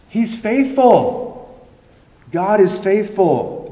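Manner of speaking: high, slow, ringing declaration of "faithful"; a preacher's climactic cry; preaching at full force. Acted. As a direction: proclaim it slowly, lifted high.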